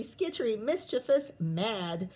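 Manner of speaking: plain reading voice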